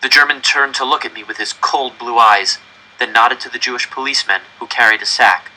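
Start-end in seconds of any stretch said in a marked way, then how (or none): none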